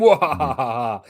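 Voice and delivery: slow hammed-up evil cackle; drawn-out villain laugh; mustache twirling villain laugh. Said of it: "the mustache twirling villain going".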